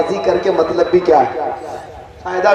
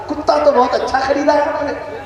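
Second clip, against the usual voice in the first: sly praise; 7 semitones higher than usual. Acted. Second swindler praises the "dog.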